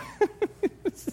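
high short chuckle; high amused laugh. Retold or imitated. Pastor reacting to the couple, high laugh.

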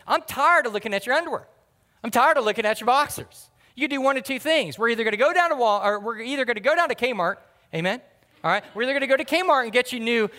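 loud and high, the exasperated mother laying down the law; fed-up mom yelling. Retold or imitated. Mom fed up with his worn pants, loud scolding. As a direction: scold him like a mom who's had enough. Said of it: "and she goes".

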